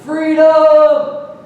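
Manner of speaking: high, loud drawn-out rallying yell; lone voice shouting out, long and high; yelled cry. Retold or imitated. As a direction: yell it out across a crowd. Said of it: "Then somebody yells".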